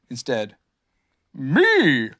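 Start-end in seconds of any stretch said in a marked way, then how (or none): 1.3-2.1 loud, drawn-out single word; mystery creature voice. A narrator's reading. Unseen animal answers Pooh, loud creature voice. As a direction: announce yourself as an odd animal. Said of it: "said a voice".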